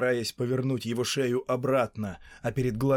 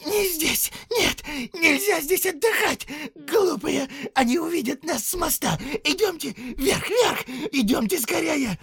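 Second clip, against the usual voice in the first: high, loud, panicked creature squeal; frantic Gollum voice; 5 dB louder than usual. A narrator's reading. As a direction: screech it in terror, much higher. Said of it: "от страха и волнения снова заговорил свистящим шёпотом".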